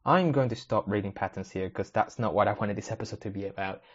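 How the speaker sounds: ordinary talk, nothing marked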